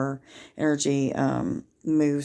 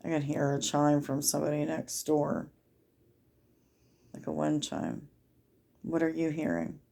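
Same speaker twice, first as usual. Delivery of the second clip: guided meditation. Acted. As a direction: lead listeners gently inward, easing off the volume.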